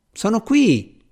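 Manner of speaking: mild high-pitched call; narrated call to someone out of sight. A narrator's reading. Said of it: "gridò Sir Geoffrey".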